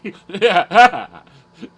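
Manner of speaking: loud, high crazy cackle; crazy laugh. Pretend. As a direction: cackle like a madman. Joking that he is crazy.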